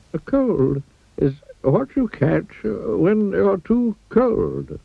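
put-on slow, high old wizard voice; wise old wizard. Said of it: "said the wise old wizard, wisely".

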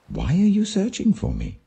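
a hint of a puzzled young boy asking; boy Jesus. A narrator's reading. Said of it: "he asked".

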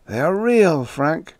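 high, anguished insistence; distressed outcry easing off; groaning. A narrator's reading. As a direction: groan it out in horror. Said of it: "hid his face in his hands and groaned".

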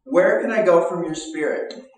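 high, loud, proclaiming the psalm; fervent psalm reading. Acted. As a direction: call out the psalm with rising fervor. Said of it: "It says".

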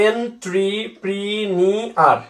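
"entrepreneur" stretched very slowly, spelled-out syllables; syllable-split reading. Shown as a demonstration. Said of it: "যদি মনে মনে বলো entrepreneur". (বানান মনে রাখতে "entrepreneur" ধীরে টেনে টেনে)

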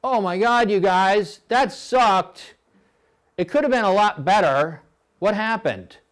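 high, loud whiny complaining; whiner. Retold or imitated. Coxswain whining to crew after bad piece, high and whiny. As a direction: whine like a sulky cox. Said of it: "Don't be a whiner".

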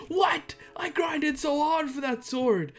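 high, whiny outraged player; mock mad. Troll mimicking player who lost his sword, mock outrage. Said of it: "now he's mad".

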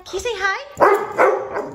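high, loud, drawn-out dog talk; slow, high-pitched prompt to a pet; coaxing a dog. Real, not acted. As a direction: coax the dog to speak, pitched right up. Coaxing her dog to speak.

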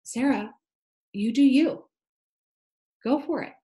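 encouraging inner voice. Retold or imitated. The bold inner voice cheering Sarah to go for it. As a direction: egg yourself on like a cheerleader. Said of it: "another voice that says".

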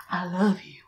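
quietly, a hint of a ghostly whisper; soft quoted whisper. Vlogger retelling the ghost's whisper, softly. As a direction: breathe it softly into the ear. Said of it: "touch my ear and whisper".

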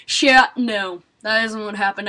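normal talking voice

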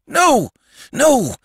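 high, loud cries; desperate denial. Acted. Sandman contradicting the boy, crying out desperately. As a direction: cry out in frantic refusal.

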